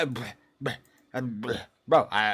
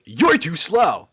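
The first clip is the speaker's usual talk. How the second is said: Sonic; 4 semitones higher, 7 dB louder, slower than usual. Acted. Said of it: "What's Sonic's tone?".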